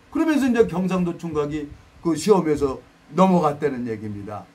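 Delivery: usual speaking voice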